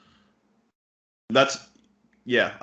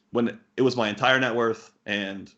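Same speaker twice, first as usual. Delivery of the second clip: normal talking voice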